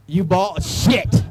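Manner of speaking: loud exclaim. Real, not acted. Asking tall woman if she balls.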